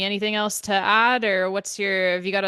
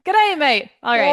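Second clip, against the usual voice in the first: a much higher, louder, silly Aussie "Good day, mate"; hammed-up Australian; 7 semitones higher, 7 dB louder than usual. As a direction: squeal a cartoonish Australian greeting. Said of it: "I'm not Australian".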